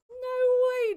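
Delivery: very high, loud, stretched out; stunned no way. Real, not acted. Streamer amazed by the game, crying out high and loud. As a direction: genuine disbelief, voice shooting up.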